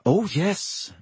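high, forced agreement; lying. Acted. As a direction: fake enthusiasm. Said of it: "they lied".

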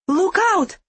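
high, sharp shout of warning; warning cry. Acted. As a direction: cry out to warn him. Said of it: "Kasha cried".

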